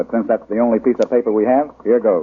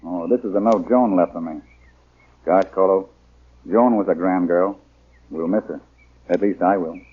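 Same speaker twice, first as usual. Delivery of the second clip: gentle melancholy over a lost friend; wistful; 5 semitones lower than usual. Acted. Reading a farewell note.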